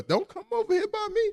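very high mock-panicked protest; alarmed shooing away. Man warding off his scared friend, mock-panicked. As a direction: pitch it way up, warding him off.

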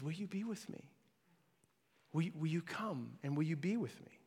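very soft, intimate asking; quiet call with space between the questions; tender invitation. Acted. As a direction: hush it right down, gently pleading. Voicing God's invitation, very softly.

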